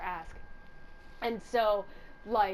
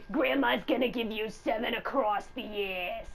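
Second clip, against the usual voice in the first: slow granny voice; slower than usual. Acted. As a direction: threaten like a mean grandma. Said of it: "Do the voice".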